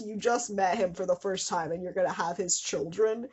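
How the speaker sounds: normal talking voice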